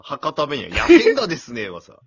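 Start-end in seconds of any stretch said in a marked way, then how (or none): 0.7-1.7 大げさに真似た親父の博多弁, loud; lightly mocking an older man's Hakata dialect; 親父の博多弁. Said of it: "しかも、親父のさ、". (おっさんの博多弁を真似て)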